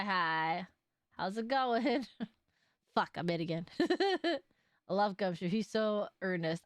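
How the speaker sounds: usual speaking voice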